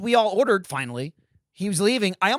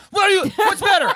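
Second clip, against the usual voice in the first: imitating a high, loud indignant demand; mock outrage; 12 semitones higher, 6 dB louder than usual. Retelling a friend challenging a pasta choice, mock outrage. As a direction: fire it back in hammed-up disbelief. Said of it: "And she's like".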